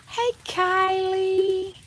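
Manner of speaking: high, slow drawn-out hey; sing-song greeting. Real, not acted. Streamer greets Kylie in the chat, high sing-song. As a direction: sing the name high and long.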